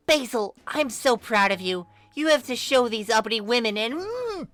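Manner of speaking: lightly voiced preachy girl; gushing zealot. Mari praising Basil.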